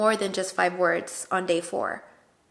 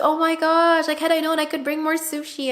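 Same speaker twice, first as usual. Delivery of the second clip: much higher and louder, eager; excited apology; 8 semitones higher, 4 dB louder than usual. Retold or imitated. Vlogger retelling her eager apology to the mom, excited. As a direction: gush it out apologetically.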